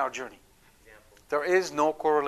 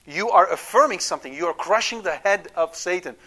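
ordinary talk, nothing marked

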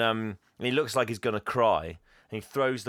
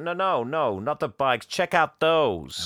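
put-on louder, higher sleazy teen voice; hammed-up perv pointing out girls; leering Bo; 5 semitones higher, 6 dB louder, slower than usual. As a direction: Bo nudging Jack to ogle, slow at the end. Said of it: "He's like".

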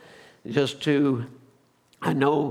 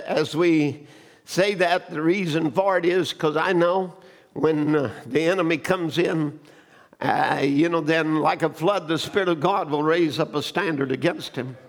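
ordinary talk, nothing marked

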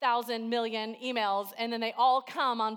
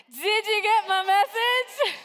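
much louder and higher, slow; loud call; 10 semitones higher, 11 dB louder, slower than usual. Shown as a demonstration. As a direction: shout it out to the whole room. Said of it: "That was so quiet. Let's try it again".

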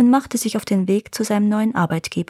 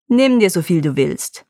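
dripping hypocrisy; 3 dB louder than usual. Acted. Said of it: "leutselig auf ... triefte ... vor Scheinheiligkeit".

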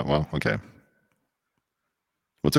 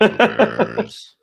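laughing; 4 dB louder than usual. Real, not acted. Laughing at the beaver joke.

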